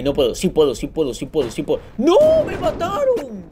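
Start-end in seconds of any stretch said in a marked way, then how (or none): 2.0-3.4 high, drawn-out wail of defeat; real anguished gamer wail; dismayed cry. Real, not acted. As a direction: cry out as you lose the game. His character just got killed.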